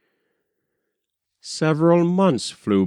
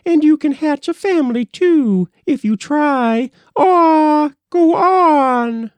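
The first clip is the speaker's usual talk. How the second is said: much higher, louder squawking bird voice; noisy blue jay; 6 dB louder than usual. A narrator's reading. Blue jay noisily calling out to finish their dispute, high and loud. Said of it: "The blue jay noisily called".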